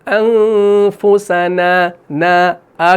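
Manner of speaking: drawn-out انفسنا with a light final aa; unrounded long نا in انفسنا; thin alif. Shown as a demonstration. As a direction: chant انفسنا slowly, keeping نا thin. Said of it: "وہ الف باریک پڑھا جائے گا".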